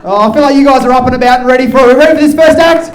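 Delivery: loud, high, building shout; hyping the crowd. Real, not acted. MC rousing the audience for the first act, full shout. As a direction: yell to whip up the room.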